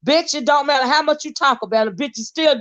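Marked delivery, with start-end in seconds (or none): none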